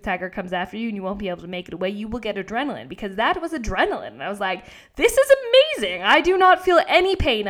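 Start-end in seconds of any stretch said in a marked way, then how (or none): 5.0-7.5 high, loud burst of elation; amazed. Retold or imitated. Runner on adrenaline feeling no pain, amazed. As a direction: marvel with emphatic punch. Retelling adrenaline rush while running.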